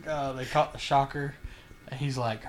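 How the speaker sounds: ordinary delivery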